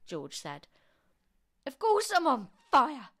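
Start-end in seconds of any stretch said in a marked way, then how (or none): 1.7-3.1 high, very loud indignant yell. A narrator's reading. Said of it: "she yelled".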